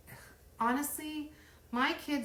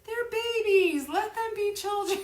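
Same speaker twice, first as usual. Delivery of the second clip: mock pleading; 14 semitones higher, 8 dB louder than usual. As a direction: coax it like defending little kids. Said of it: "I'm like".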